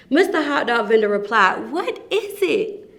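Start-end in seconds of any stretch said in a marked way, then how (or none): 1.7-2.9 higher curious voice; curious question. Acted. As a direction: ask it high and wondering. Vendor asks curiously.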